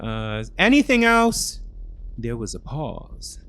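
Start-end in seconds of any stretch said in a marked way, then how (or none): 0.6-1.6 exasperated. Acted. Exasperated reply.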